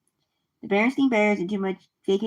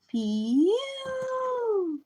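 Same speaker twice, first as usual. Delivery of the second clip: long, high swooping cry; exaggerated stink exclamation; 14 semitones higher, 4 dB quieter than usual. Reader reacts to the smelly night, drawn-out high exclamation. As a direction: stretch it out high, then let it fall.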